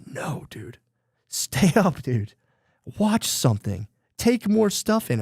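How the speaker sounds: a mild, drawn-out nagging inner voice; lightly voiced inner toddler. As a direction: lightly egg yourself on to stay up. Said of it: "part of me that's just like".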